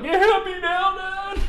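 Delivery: very high tearful shriek at Dad; hammed-up bawling kid's holler; mock crying yell. Kid shouting to his dad after a touchdown, still wailing. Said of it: "I'm still fucking crying".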